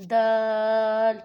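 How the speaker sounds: دال drawn out very long and loud; long madd on dal. Shown as a demonstration. Teacher modelling the long دال for the class. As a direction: hold the vowel of دال for four beats. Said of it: "چار انگلیوں کے برابر لمبا".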